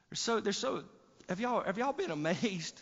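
cracked voice on a high note; voice cracking. Real, not acted. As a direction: voice breaks mid-phrase. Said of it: "I don't know why my voice cracked right there".

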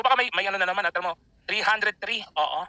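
ordinary talk

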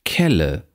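"Kelle" with a short open e; short lax vowel. Shown as a demonstration. Lecturer reads minimal pairs, short lax vowel. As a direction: keep the first e in "Kelle" brief.

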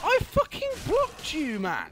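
very high, loud, slow screamed protest; outraged scream. Real, not acted. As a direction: scream the injustice at the enemy. Player raging at enemy.

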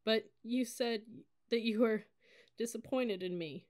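broken crying protest; tearful hurt. A narrator's reading. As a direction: falter toward the painful word.

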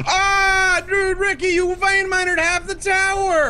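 high-pitched play-angry accusation; mock outrage. Blaming Ricky for wrecking the tower, high mock outrage. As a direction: whine the blame up high, half joking. Blames friend for vein mining.

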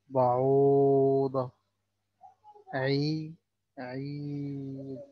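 عالي and عيد with the vowel drawn out slowly; long vowel. Shown as a demonstration. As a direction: stretch the long vowel after the ain. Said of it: "طيب الطويل". (مد الصوت الطويل ببطء)

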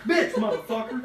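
ordinary talk

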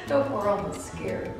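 normal talking voice

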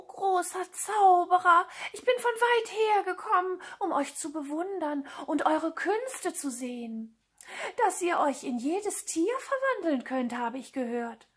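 high, fawning admirer's voice; flattering cat. Acted. Cat bows before the great sorcerer, high flattering voice.

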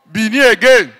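ordinary talk

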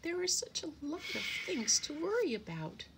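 high, fretful sparrow voice; overwhelmed little bird; sighing, worried. A narrator's reading. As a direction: lament it with a worried sigh, pitched high.

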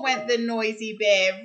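put-on lively read-aloud for little kids, bright and bouncy; story narration. Teacher reading a rhyming picture book to children, animated.

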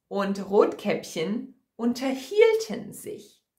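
ordinary delivery, nothing marked

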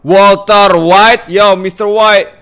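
loud, slow, high call; big drawn-out hailing yell; excited holler. Pretend. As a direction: shout the name, stretching each word.

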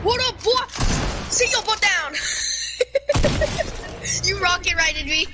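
high, loud trash-talk taunt; hyped gamer bro. Real, not acted. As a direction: gloat at your buddy. Taunting friend after kill.

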